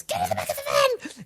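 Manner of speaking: a quick hammed-up film impression; film quote impression. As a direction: friend quoting a film line about losing patience, in a high, soft mock voice. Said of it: "when he goes".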